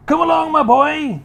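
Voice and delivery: put-on high, eager old man urging the boy on; excited Mister Cranky. Mister Cranky urging George on, high and eager.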